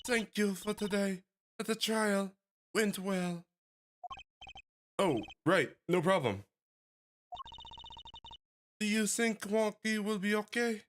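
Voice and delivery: put-on high voice with a French accent. Miss Tyler thanking the lawyers, in a French accent. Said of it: "giving her a French accent".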